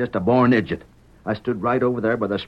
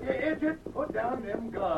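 much softer, slow urgent warning; urgent hiss; 10 semitones higher, 11 dB quieter than usual. Acted. Father warns son not to shoot.

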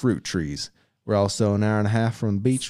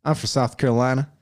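bad Southern accent; 4 semitones higher than usual. Shown as a demonstration. Husband fakes Southern accent for wife to judge, badly. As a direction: try a Southern accent and miss. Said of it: "Is that good?".

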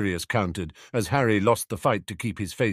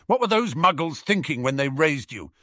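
raised-pitch exasperated question; indignant; 7 semitones higher, 4 dB louder than usual. A narrator's reading. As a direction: demand it with some indignation. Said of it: "Sirius huffed indignantly".

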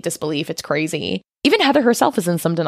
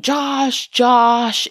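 screaming; 6 semitones higher than usual. Retold or imitated. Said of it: "Mike screaming".

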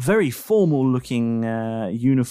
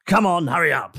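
lightly voiced brisk officer; 3 semitones higher, faster than usual. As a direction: hurry them along as the officer.

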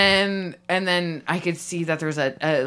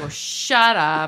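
slow, louder mock outburst; forceful rebuke; 3 semitones lower, 5 dB louder, much slower than usual. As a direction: drag it out as if hitting him. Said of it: "And you just hit him".

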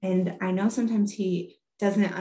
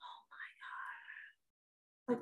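barely audible whispered dismay; retold hushed gasp of disbelief; stifled shock; 4 semitones higher, 19 dB quieter than usual. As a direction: whisper it, holding the upset in. Mom stifling shock at daughter's claim.

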